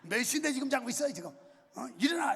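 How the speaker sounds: loud barked wake-up order; yelling wake-up call. Retold or imitated. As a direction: kick the door and yell them out of bed. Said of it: "난 발로 걷어차고 일어나!".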